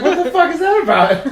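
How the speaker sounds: loud, high-pitched baffled outburst; mock bafflement. Acting out his reaction to the meme, mock bafflement. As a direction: imitate someone appalled, voice shooting up.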